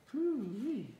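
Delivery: very high disgusted hoo-wee at a stink; disgusted hoot. Acted. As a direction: squeal it out like something reeks. Mole holds his nose at smell.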